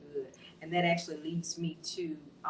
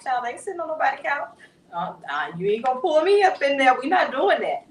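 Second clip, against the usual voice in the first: high, loud, emphatic protest; hammed-up flat-out refusal; mock indignant refusal; 8 semitones higher, 11 dB louder than usual. Imitating our people refusing the therapist's couch, mock indignant refusal.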